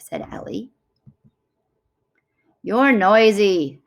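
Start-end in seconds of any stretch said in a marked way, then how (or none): 2.6-3.8 slow, louder grumpy harumph. Acted. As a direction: huff it out, annoyed and slow. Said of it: "harumphed Chimp".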